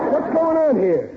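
high, drawn-out indignant question; indignant comic outburst; suspicious demand. Acted. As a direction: demand an explanation as a suspicious father. Suspicious father confronts wife and daughter.

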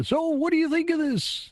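very high-pitched clown show-host voice; Bozo the Clown. Retold or imitated. Bozo asking a kid on live TV, high clown voice. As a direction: squeal it like a kids' TV clown. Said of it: "I love your Bozo voice".

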